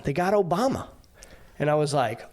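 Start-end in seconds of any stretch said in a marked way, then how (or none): 0.0-0.9 stranger's news. Retold or imitated. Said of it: "He said".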